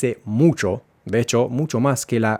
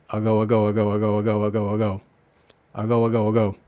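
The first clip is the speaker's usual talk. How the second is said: fast repeated ago; faster than usual. Shown as a demonstration. Teacher repeating ago quickly for students. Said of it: "pero rápido".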